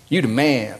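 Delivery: [name] pointing at David, said Southern style; a hint of a Southern preacher; Southern accent. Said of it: "goes all Southern on him".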